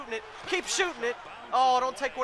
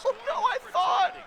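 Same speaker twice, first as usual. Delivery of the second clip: laughing; 13 semitones higher than usual. Real, not acted. Laughing at own reaction.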